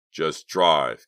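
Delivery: loud, firm order; insistent. A narrator's reading. Leader ordering the reluctant driver on, insistent. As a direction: press it hard on the driver. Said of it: "Clay insisted".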